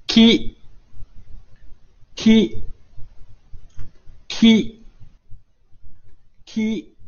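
提高音调示范ki; model ki. Shown as a demonstration. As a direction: say ki clearly for students to copy. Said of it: "注意这个发音".

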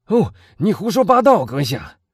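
high, loud indignant outburst; loud shout. Acted. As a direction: shout him down in protest. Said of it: "威尔夫大声嚷着". (大声嚷着)

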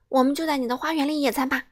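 fast and bright; quick eager suggestion; rapid chatter. Acted. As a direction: rattle off the plan. Mr. Busy rattles on.

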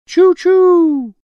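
high, loud choo-choo call; train whistle. A narrator's reading. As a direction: toot like a steam train. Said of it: "A train calls".

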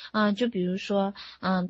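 usual speaking voice

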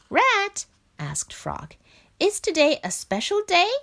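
a curious little frog asking; Frog's voice. Acted.

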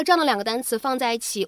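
ordinary talk

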